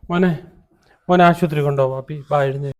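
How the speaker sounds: put-on father urging the sick boy up; urgent call. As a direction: rouse him, urgently. Son sick, urgent calling.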